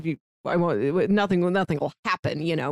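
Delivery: usual speaking voice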